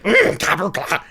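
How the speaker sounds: high hammed-up goblin munching; mock goblin chewing; goblin eating noises. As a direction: gobble it like a greedy goblin. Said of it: "I'd be like".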